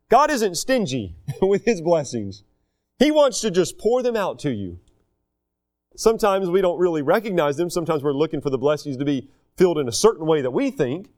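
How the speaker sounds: normal talking voice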